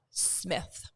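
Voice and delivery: put-on hushed, whispered American accent. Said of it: "my best American accent".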